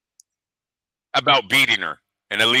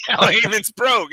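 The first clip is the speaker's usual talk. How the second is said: Al Heyman's broke, much higher through laughter; laughing repeat; 11 semitones higher than usual. Real, not acted. Host repeating the joke to his cohost, laughing. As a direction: laugh it out, savoring the line.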